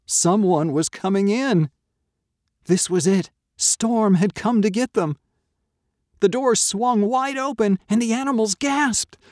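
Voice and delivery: high, loud suspenseful narration; mounting alarm. A narrator's reading. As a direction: build the dread to a climax. Suspense climax of the story.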